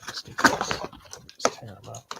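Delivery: breathy whisper to himself; whispered aside. Real, not acted. Pulling out the targets, whispering to himself. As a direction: whisper it under your breath.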